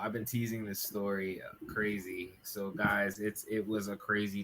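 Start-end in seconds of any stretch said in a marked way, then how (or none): none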